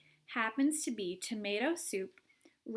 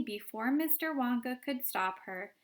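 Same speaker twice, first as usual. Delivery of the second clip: usual speaking voice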